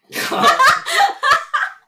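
loud, high laughing fit; real high, loud laugh; burst of laughter. Real, not acted. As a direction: burst out laughing at your own joke. Cracks up at collar joke.

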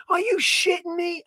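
can't believe the tail just came off; shocked disbelief. Retold or imitated.

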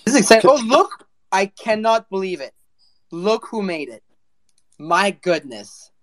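excited greeting. Real, not acted. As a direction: greet him like a hero arriving. Hosts greet an arriving guest.

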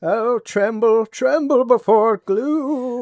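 slow, high creature voice; high, slow little creature voice; Gurgi voice. Pretend. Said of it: "do, do, do his glue".

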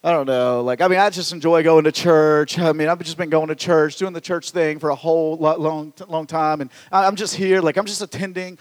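mild mock of a shrugging pew-sitter; reluctant churchgoer.

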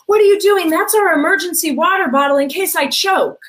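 high, loud panicked scolding; shrill-free frantic mom snapping at her son; mom flipping out. Retold or imitated. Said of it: "the mom flipped out and said".

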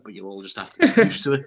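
ordinary talk, nothing marked

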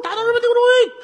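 very high, loud slogan cry like a train whistle; train-whistle slogan shout. Acted. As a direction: shriek the slogan like a steam whistle. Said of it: "火车的声音嘛". (像火车汽笛一样尖声喊口号)

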